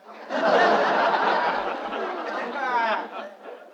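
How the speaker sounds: breathless airy laughter; wheezing laugh. Acted. Father laughs at Heck's nerve on the phone, breathless laughter. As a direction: laugh silently until it bursts.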